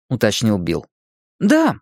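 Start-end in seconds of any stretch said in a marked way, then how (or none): 1.4-1.8 put-on high-pitched boyish cry, little added volume. A narrator's reading. Mike answers Bill, high excited cry. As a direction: call it out high and short. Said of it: "крикнул Майк".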